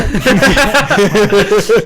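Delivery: sudden real laugh; burst of laughter. Real, not acted. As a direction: laugh it off, a little embarrassed. Laughs at being rebuffed.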